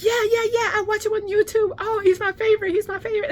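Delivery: very high, loud fanboy gushing; Finn gushing. Retold or imitated. Friend explains he watches the famous musician, imitated excited gushing. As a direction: imitate a thrilled fan, words tumbling out. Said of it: "he was just beside himself".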